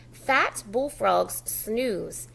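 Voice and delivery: ordinary talk, nothing marked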